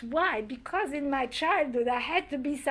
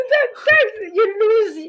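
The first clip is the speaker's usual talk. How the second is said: much higher and louder, laughing through the words; real laughter; 9 semitones higher, 12 dB louder than usual. Real, not acted. Recalling her brother's joke, laughing loudly. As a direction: burst out laughing mid-sentence.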